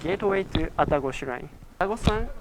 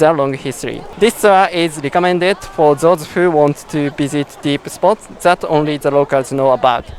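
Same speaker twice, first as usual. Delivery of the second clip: louder, projected tour-video intro read; presenter intro; 9 dB louder than usual. Acted. Host introducing the Okusaga tour to viewers, projected presenter read. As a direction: pitch the tour to the camera like a host.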